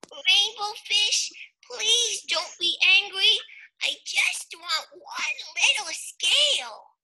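high, slow pleading small fish; little fish voice. Acted. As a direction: beg gently in a tiny high voice.

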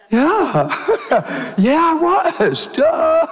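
laughing through a sheepish yes; laughing admission. Retold or imitated.

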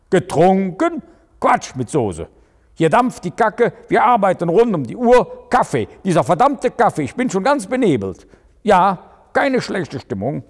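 höher und lauter, aufgebracht abwehrend; empört beschwipst. A narrator's reading. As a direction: ertappt und polternd verteidigen. Prick empört, beschwipst am Telefon. („Getrunken?“ laut und empört zurückgeworfen)

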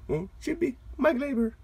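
normal talking voice

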